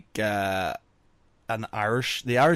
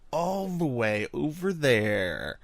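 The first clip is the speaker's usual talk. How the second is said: slow, elongated "all the way over there"; drawn-out dismissal; 3 semitones higher, 4 dB quieter, much slower than usual. Acted. British banishing convicts far away, drawn-out dismissal. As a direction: stretch it out to sound very distant.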